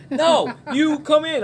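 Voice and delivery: high slow no you come in; hammed-up stubborn friends; insistent friends. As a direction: stretch it out stubbornly. Buddies arguing through window.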